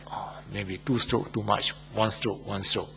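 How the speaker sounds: very soft, low relenting murmur; hushed second thoughts of a softening parent. Acted. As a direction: back down under your breath. Parent relents further at more tears.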